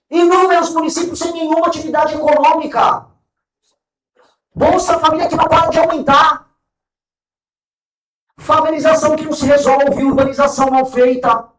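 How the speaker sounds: loud, forceful indignation; loud indignant. Real, not acted. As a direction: hammer each point home loudly. Rally speech, rising indignation.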